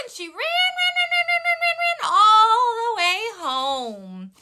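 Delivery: very high, quick repeated rans; breathless running rush. A narrator's reading. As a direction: chant it as she races home. Goldilocks flees in story.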